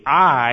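"I" punched loud and drawn out; emphatic stress on I. Retold or imitated. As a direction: land hard on the word "I". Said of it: "it's emphatic".

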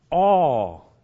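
all swelled out loud and long; stress on all. Acted. Preacher stressing all to the whole crowd, loud. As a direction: throw out the word all to everyone.

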